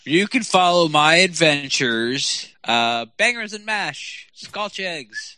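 slow, high put-on English accent. Friend plugging his adventures in a mock English accent. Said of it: "I was doing an English accent".

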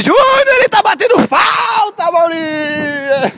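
high shouted play-by-play call; announcer shout. Acted. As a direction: yell it like a match announcer. Announcer calling the kick.

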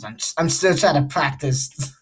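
normal talking voice

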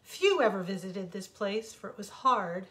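usual speaking voice

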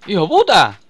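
loud furious curse; angry shout. Real, not acted. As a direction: yell the curse in anger. Cursing at attacking mob.

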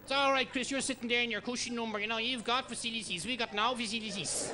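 high, rough Dublin guest voice, as mock as can be; a tough Dublin lad's voice, played over the top; mock caller from the show. Mimicking a Chris Barry Show guest.

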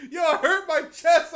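loud and high, laughing; breathless laughing complaint; cracking up after a scream. Real, not acted.

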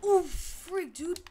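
sudden high startled cry; startled yelp. Real, not acted. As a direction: jump at the scare. Sudden scare in game.

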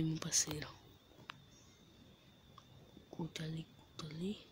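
hushed, unvoiced whisper; sneaking whisper to a teammate. Real, not acted. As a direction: whisper so the enemy won't hear. Hiding in game, whispering.

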